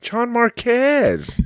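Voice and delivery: high, slow, drawn-out name reveal; mock accusation. Host teasing a fellow driver on air, mock accusing drawl. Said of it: "Just kidding".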